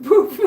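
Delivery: high, loud poof; loud mock poof. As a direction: pop it like a little explosion. Sound effect of glitter.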